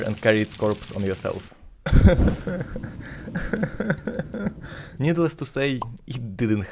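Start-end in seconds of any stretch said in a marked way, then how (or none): 1.8-4.9 breathy laugh. Real, not acted. Laugh on its own line.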